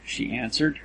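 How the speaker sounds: usual speaking voice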